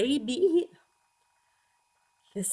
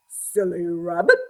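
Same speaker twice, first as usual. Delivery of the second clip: a slightly lower, louder owl; 3 semitones lower, 5 dB louder than usual. A narrator's reading. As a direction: tease him like a wise owl. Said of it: "said the owl".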